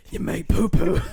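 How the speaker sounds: loud deadpan action-hero line; Jason Statham impression. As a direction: say it like a tough guy. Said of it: "all night I've just been like".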